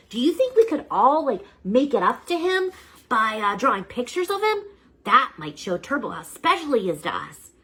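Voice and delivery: put-on a sorry kid pitching an idea; earnest kid. Eugene asking the teacher about drawing pictures, earnest kid voice. Boy proposes making amends.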